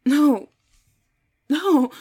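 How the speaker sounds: loud, high cries of no; alarmed refusal. Acted. Victim refusing her attacker, alarmed cries. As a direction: cry out in dread.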